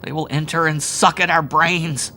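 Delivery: fearful wail; terrified. A narrator's reading. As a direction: Howard in horror. Howard moaning in terror.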